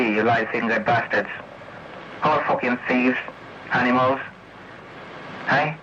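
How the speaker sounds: ordinary talk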